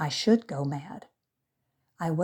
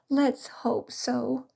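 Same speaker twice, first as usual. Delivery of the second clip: higher, slow tearful reply; slow weepy hope; sobbed; 7 semitones higher, much slower than usual. A narrator's reading. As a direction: say it through sobs. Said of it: "sobbed Delia".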